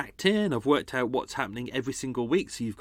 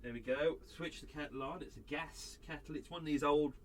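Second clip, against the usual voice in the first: ordinary delivery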